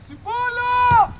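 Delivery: high, drawn-out shout. Real, not acted. Coach urging players onto the ball, shouting. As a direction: yell it long and high across the pitch.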